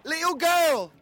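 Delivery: high excited calling. Acted. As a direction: hail someone down the street. Calling to girl in street.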